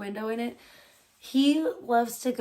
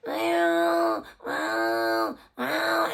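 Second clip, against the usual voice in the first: imitated cat meowing; 5 semitones higher, much slower than usual. Imitating her cat's meows while telling viewers about sleepless nights. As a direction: meow like a needy cat, pitch jumping up and down.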